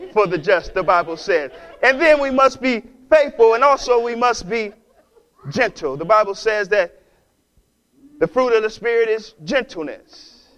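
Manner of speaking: usual speaking voice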